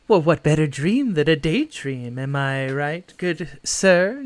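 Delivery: lively in-character banter with a lilt; a hint of a whimsical, daydreaming woman; playful mysterious woman. Voices the woman with golden eyes.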